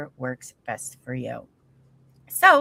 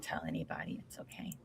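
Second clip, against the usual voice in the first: whisper; 17 dB quieter than usual. Pretend. Conspiratorial joke to a listener.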